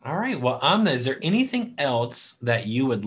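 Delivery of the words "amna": ordinary talk, nothing marked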